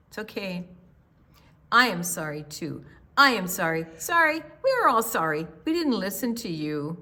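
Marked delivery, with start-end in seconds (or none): none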